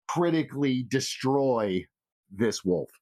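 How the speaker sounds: ordinary talk, nothing marked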